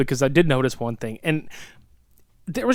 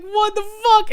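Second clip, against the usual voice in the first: much higher, stunned; mock disbelief. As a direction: squeal out the disbelief. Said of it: "You're like".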